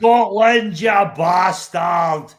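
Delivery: put-on Scottish accent. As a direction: roar it like a Glasgow terrace chant. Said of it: "do a bit of me".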